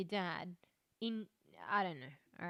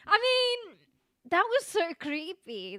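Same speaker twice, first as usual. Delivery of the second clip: excited; 8 semitones higher, 7 dB louder, slower than usual. Real, not acted. Reacting to creepy ending.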